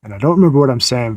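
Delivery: normal talking voice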